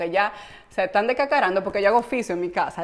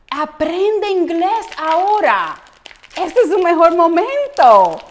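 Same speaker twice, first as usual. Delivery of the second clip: slow, louder, higher announcer pitch for a language course; mock ad voice; 7 semitones higher, 8 dB louder, slower than usual. Host mimics a language-course ad, hammed-up announcer voice. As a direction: sell it like a TV ad. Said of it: "vete ahí a Robert, Roberta Stone".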